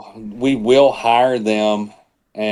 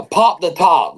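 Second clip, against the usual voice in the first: mock radio call; 7 semitones higher, slower than usual. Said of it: "try to be funny".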